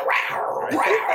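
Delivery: high mock cougar growl; imitated cougar growl. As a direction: growl like a stock-sound cougar. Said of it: "that sound effect you always hear of a cougar growling".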